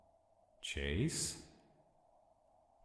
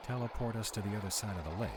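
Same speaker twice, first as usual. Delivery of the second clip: urgent order; 7 semitones higher, 3 dB louder, faster than usual. Acted. Urgent order during danger.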